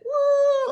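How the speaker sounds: high drawn-out mock wail. Speaker showing the audience her ugly cry, mock wail.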